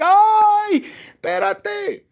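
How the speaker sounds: high, loud mock yelp; acted outcry. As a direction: cry out on the first word, then ease off. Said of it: "you get up and you're like".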